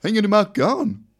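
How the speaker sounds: a light Gothenburg lilt, asking; Gothenburg accent. Retold or imitated. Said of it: "Jaha, vänta". (lätt göteborgska, frågande)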